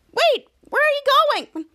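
high, loud questioning call; lightly voiced little sister; high girl voice. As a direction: call after them, alarmed. Piper calling after Charlotte.

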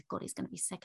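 voiceless worried aside to herself; whispered thought. Retold or imitated. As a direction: hiss it under your breath in dread. Inner panic at husband's sickness.

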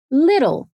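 careful "little", middle t tapped; flap T. Shown as a demonstration. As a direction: say "little" American style, like "liddle". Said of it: "Vamos treinar mais algumas palavras".

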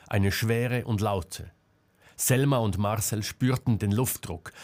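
ordinary delivery, nothing marked

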